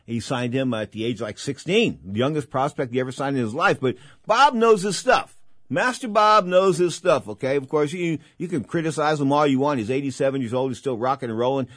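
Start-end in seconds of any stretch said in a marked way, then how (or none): 4.3-7.3 loud, high, slowed emphatic praise. Real, not acted. Host booming praise for veteran promoter Bob. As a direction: boom it out in admiration.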